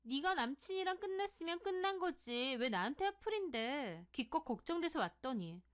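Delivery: high-pitched, aggrieved scolding; angry outburst. A narrator's reading. Sohee lashes back at her accusing friend, angry and aggrieved. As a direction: turn the anger back on the accuser. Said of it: "소희는 본인이 더 화를 내더라고요".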